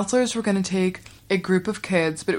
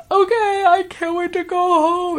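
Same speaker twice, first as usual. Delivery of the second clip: very high, louder mock-tearful squeal; crying; 12 semitones higher, 4 dB louder than usual. Sobbing girl answers the counselor, very high mock cry. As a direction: imitate a kid squeaking through tears. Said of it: "with her crying".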